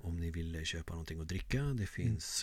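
soft, halting train-host announcement. Acted. Host imitates train staff selling coffee, soft halting voice. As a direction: murmur the refreshment notice with long stretches. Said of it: "Tågvärdar är lite".

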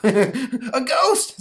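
put-on high, loud gasping boy; terrified kid. Boy seeing the ghost, high and panicked. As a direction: shriek at the ghost.

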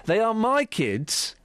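very slow, dragged insistence; slow stubborn refrain. Acted. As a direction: drag each word out defiantly.

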